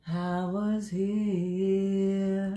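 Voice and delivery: slow dramatic pronouncement. Acted. Slow grand legacy statement.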